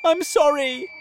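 high, loud wail of apology; distressed cry. A narrator's reading. James apologises to his falling friends, distressed cry. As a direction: cry it out desperately. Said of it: "cried James".

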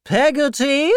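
high, rising disbelief at the name; incredulous repeat. Acted. Aunt Betsy repeats the servant's name, high and incredulous. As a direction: scoff at the odd name. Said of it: "repeated Miss Betsy".

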